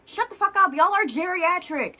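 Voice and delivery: high, loud exasperated retort; real angry outburst. Real, not acted. Snaps back at the hosts, ranting.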